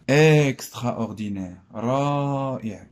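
loud, drawn-out exclamation; demonstrative, slow exclamatory reading; exclamation of feeling. Shown as a demonstration. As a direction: stretch it out with emphatic admiration. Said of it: "كنكسبريمي لي سونتيمون".

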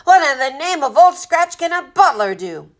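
high, loud incredulous outburst; roaring. A narrator's reading. Captain scoffing at a butler's usefulness, roaring. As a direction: roar the question in disbelief. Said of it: "the captain roared".

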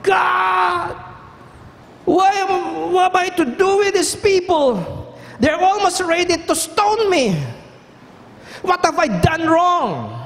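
high, desperate cry upward; crying out to God. Retold or imitated. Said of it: "Moses cried out".

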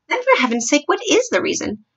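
high-pitched, impatient demand; exasperated. A narrator's reading. Queen pressing her faltering maid for a reason, exasperated. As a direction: pitch leaping up in mild exasperation.

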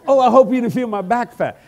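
preacher mimicking the lady he hugged, loud and high. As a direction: repeat her worry in a high, loud squeal. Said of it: "What did you say?".

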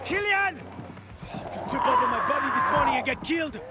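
desperate yell for Killian to open up; shouting. Acted. Game character yelling at Killian outside. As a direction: bellow through the door.

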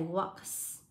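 walks with a hissed final s; slow walks, ending in a drawn-out voiceless s; final S sound. Shown as a demonstration. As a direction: let the end of walks hiss. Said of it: "được đọc cho cô là âm S".

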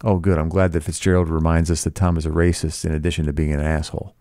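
plain reading voice

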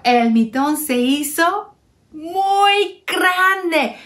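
usual speaking voice

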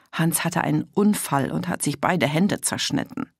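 ordinary delivery, nothing marked

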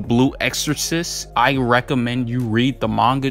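ordinary talk

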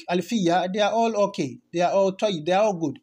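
normal talking voice

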